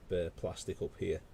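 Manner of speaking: ordinary delivery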